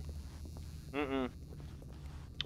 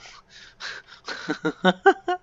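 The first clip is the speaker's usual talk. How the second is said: burst of laughter; 9 semitones higher, 7 dB louder than usual. Real, not acted. Laughs at game option.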